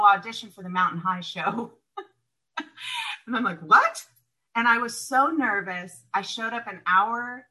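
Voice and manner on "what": higher, louder disbelieving What?; can't believe the suggestion; incredulous what. Retold or imitated. Shocked at audition suggestion.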